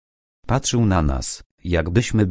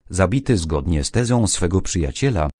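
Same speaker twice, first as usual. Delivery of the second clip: plain reading voice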